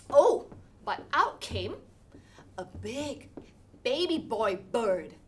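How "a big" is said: ordinary delivery, nothing marked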